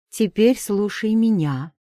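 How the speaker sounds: steely. A narrator's reading. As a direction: cut him off with steel. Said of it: "в моём голосе появились те самые стальные нотки".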